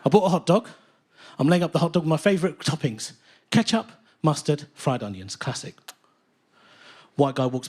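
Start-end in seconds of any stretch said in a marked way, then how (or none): none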